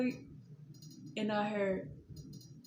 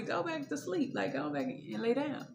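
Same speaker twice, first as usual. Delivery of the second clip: Eli dismissing Samuel; 7 semitones higher than usual. Retold or imitated. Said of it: "And he's like".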